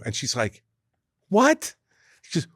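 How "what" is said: high, startled one-word reply; acted-out disbelief, pitched way up; retold "huh?" with a jump in pitch; incredulous surprise. Wife's startled reply to the call.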